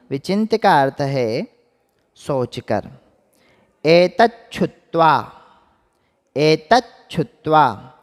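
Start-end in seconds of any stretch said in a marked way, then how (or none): 3.8-7.9 एतच्छुत्वा said slowly and louder; slow pronunciation. Shown as a demonstration. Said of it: "अलग करके बोले तो".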